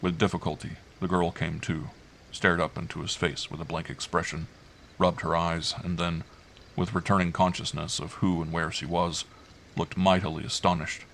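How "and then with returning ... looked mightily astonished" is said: plain reading voice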